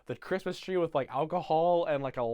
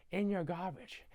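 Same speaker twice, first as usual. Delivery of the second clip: softly acted, understated aside; quiet mock confiding; 3 semitones higher, 6 dB quieter than usual. Grinch confronting the Whos, quiet first mention. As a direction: keep it soft, like confiding in them.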